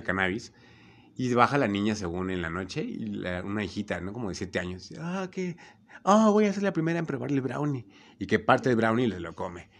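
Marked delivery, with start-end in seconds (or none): 5.0-7.9 higher, put-on little girl voice. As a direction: voice the eager seven-year-old, pitched up. Niña de siete años habla. (voz de niñita, más aguda)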